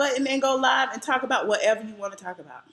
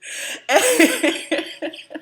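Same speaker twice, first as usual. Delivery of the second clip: real, high burst of laughter; amused laugh; 7 semitones higher than usual. Real, not acted. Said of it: "I'm laughing because it's just funny".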